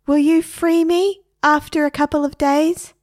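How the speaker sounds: high, loud pleading parrot; a parrot begging its owner for release, high and loud. A narrator's reading. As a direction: beg to be set free.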